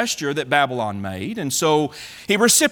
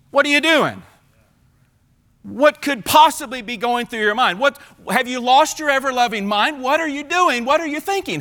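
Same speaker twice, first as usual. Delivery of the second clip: much higher, exasperated what-are-you-doing; incredulous rebuke; 7 semitones higher than usual. Acted. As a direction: scold in disbelief, pitched up. Isaiah confronts Hezekiah.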